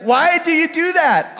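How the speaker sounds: high, slow, incredulous plea; exasperated owner. Retold or imitated. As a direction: wail it at the dog in mock despair. Exasperated owner questioning his dog.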